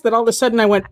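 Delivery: normal talking voice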